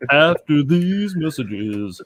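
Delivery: slow, stretched network promo read; TV announcer. Pretend. Co-host following up a failed cartoon joke, TV announcer. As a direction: announce the next show with drawn-out polish. Mock TV bumper.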